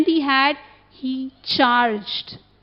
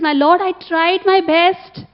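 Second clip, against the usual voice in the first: slow, higher, louder lament of a dying bird; 5 semitones higher, 6 dB louder, slower than usual. Retold or imitated. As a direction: mourn what you failed to do, slowly. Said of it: "He was just lamenting".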